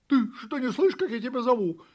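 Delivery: high, loud, quick reproach; indignant puppy. A narrator's reading. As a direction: demand an answer from someone ignoring you. Puppy cross at being ignored. (щенок возмущается)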